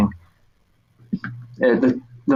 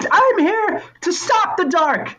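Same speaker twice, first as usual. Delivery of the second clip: put-on much higher, slow defiant boy voice; boy hero; slower than usual. Boy insisting the figure fight him, high and defiant. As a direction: insist like a stubborn child.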